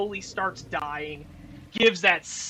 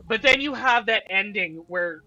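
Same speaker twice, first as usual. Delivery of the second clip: usual speaking voice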